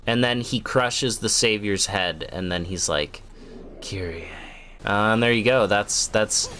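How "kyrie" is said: low, soft deadpan utterance of a name; deflated mock call. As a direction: let the dramatic cry fizzle out quietly. Running gag ends in deadpan call.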